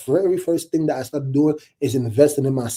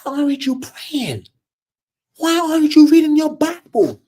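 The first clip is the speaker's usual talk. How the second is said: high-pitched mock scream of reproach; 12 semitones higher, 5 dB louder than usual. Preacher acting out the rebuke he wishes he heard, screaming. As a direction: cry it out high, as if scolding the flock.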